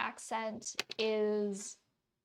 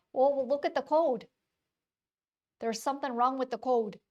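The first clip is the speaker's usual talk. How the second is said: loud, exasperated Wisconsin vowels; deep Wisconsin accent; 3 semitones higher, 7 dB louder than usual. Retold or imitated. Telling boss to look at the code, loud deep Wisconsin accent. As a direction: go full-on broad Wisconsin, louder and worked up. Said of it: "the deepest Wisconsin accent just came out".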